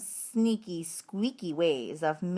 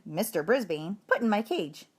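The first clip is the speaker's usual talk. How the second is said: ordinary delivery, nothing marked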